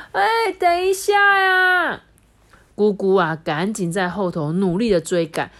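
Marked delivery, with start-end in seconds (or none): 0.1-2.0 high, slow shouted plea; loud, stretched call to wait; calling after. Acted. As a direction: yell after the flying bird. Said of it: "大家就说".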